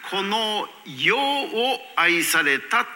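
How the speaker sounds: 「世」 drawn out slowly and set apart by pauses; 「世」を強調. Shown as a demonstration. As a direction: stretch out 「世」 as the key word of the verse. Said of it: "「世」というところ". (「世」をゆっくり伸ばして強調)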